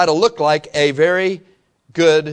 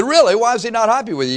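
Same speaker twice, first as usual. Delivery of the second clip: surprised question; 7 semitones higher, faster than usual. Retold or imitated. Pastor retells talk with young man.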